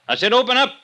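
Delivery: high, loud, furious repeat; angry shout. Acted. Detective yelling again at the locked door. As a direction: lose patience and bellow it.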